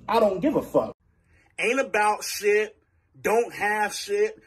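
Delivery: usual speaking voice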